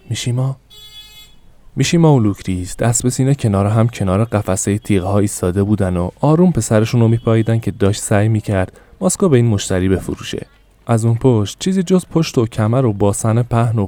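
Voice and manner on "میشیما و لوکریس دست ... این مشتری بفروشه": ordinary delivery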